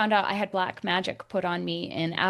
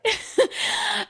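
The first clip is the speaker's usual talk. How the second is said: high disbelieving laugh; 12 semitones higher, 4 dB louder than usual. Real, not acted. Laughing at absurd accusation.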